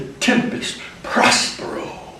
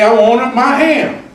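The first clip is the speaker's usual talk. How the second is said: higher, louder, slow insistent plea; Hambone demanding ham; 10 semitones higher, 8 dB louder than usual. Acted. Hambone demanding his ham, loud and insistent. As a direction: plead for the ham stubbornly. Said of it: "Hambone said".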